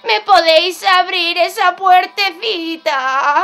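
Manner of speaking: slow, bleating sing-song plea; fake little sheep. Wolf disguised as a sheep begs the piglets, bleating plea. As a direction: drag out every word like a pitiful sheep. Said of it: "se disfrazó de ovejita".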